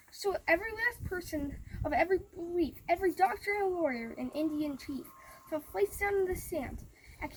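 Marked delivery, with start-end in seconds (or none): none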